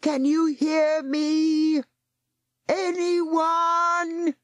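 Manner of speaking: desperate calling. Acted. Ben calling out on shore.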